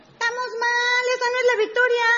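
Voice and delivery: high sing-song put-on voice; mock correction. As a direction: correct them in a silly high voice. Mock correction of the imagined reaction. (corrección burlona con voz aguda)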